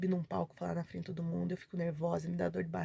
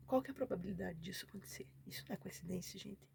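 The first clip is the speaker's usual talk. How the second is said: near-whispered, quicker rhetorical question; hushed awe; 13 dB quieter, faster than usual. Real, not acted. Telling viewers of the impossible coincidence, hushed awe. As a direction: drop to a stunned hush, fading away.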